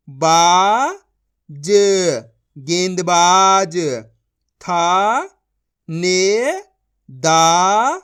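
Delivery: loud, drawn-out reading of each syllable; slow syllable drill. Shown as a demonstration. Teacher sounding out words for young pupils, slow and loud.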